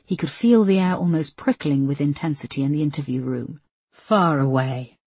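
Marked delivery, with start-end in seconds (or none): 3.9-5.0 slow, dark murmur; darkly. A narrator's reading. Linda answers Peterson with slow dark menace. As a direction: let it sink, slow and ominous. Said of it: "said Linda darkly".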